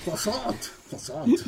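put-on high, hammy French accent; bad French accent. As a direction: overdo the French croissant. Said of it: "bad French accent".

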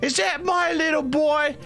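very high, indignant mother; guilt-trip a neglectful son in a high-pitched voice; scolding kobold mama. Acted. Kobold mother spotting her little boy Beetle, scolding.

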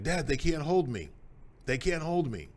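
retold yell. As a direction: shout it back across the field, confident. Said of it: "he yelled back".

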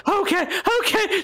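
very high, hurried eager okay; eager gambler girl. Acted. Desperate gambler agreeing to continue, very high eager voice. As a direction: squeak out the yes, desperate to win back. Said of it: "she's like".

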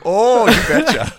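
hammed-up you-betcha voice; mock Midwestern twang. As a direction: ham up a rural Midwest drawl. Folksy catchphrase bit.

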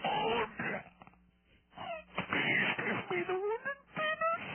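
high, wailing hammed-up beg; mock sobbing plea. Abbot caller begs the host tearfully in mock despair. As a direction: cry out like a desperate old monk.

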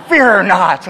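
loud roaring shout; dinosaur voice. Retold or imitated. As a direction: roar it like a dinosaur. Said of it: "she goes".

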